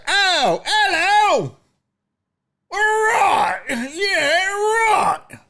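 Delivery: very high, hammed-up British accent; mock London accent. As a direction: parody a newly acquired London accent. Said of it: "then you move to London".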